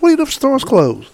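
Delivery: high, pointed mock question; challenging question. Speaker quizzing the kids, high challenging question. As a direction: ask it high to catch them out. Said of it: "I said".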